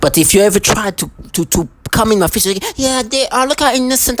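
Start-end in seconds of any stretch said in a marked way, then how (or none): 2.8-4.2 high, sing-song mock child voice; mock childish voice. Mocking the abuser's put-on innocent child voice. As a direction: mimic a fake-innocent kid, pitched way up.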